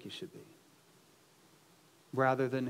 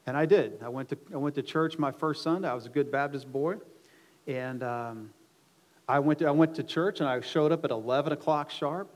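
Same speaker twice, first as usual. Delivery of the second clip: normal talking voice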